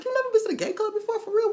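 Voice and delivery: very high, fast, loud disbelief; shocked. Retold or imitated. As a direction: gasp it out fast.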